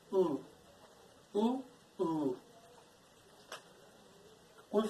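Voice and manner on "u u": soft, shaped "U, U" with the lips pushed forward; mouth position demo. Shown as a demonstration. Teacher showing students the lip shape, softly. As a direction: mould the vowel in "U, U" carefully and quietly. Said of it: "We put our mouth on a different position".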